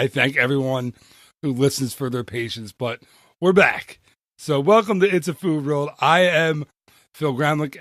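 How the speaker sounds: ordinary delivery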